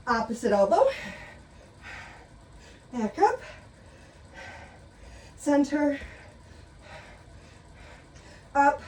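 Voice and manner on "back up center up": panting between short cues; breathless from the workout; out of breath. Real, not acted. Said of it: "I'm panting".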